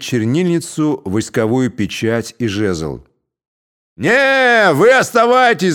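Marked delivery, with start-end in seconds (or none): 4.0-5.7 loud high shout from the crowd. A narrator's reading. Cossacks shouting to the judge and scribe, loud. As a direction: yell it out over the crowd. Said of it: "закричали из толпы".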